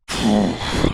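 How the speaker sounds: loud exasperated exhale; big puffed-out sigh; heavy sigh. Real, not acted. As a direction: blow the breath out hard. Exasperated at the succession mess.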